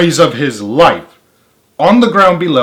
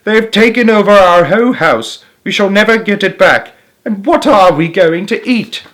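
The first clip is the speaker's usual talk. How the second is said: despairing; 8 semitones higher, 5 dB louder than usual. A narrator's reading. Wife despairs over lost house.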